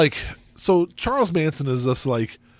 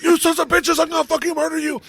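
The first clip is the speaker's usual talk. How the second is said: hammed-up murderous threat; mock Manson rant; 12 semitones higher than usual. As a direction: rant like a madman, faster at the end.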